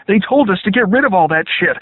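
high, loud scared soldier; panicked protest. A narrator's reading. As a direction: plead loudly and high. Captive protests to screaming soldier.

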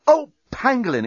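high burst of mild swearing; dismayed exclamation. A narrator's reading. Journalist reminded of the bomb, dismayed exclamation. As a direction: exclaim it like a sudden curse. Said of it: "exclaimed the journalist".

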